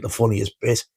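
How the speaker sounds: ordinary talk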